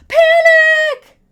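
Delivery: high, loud panicked scream; panicked shriek. Acted. Step one of emergency plan.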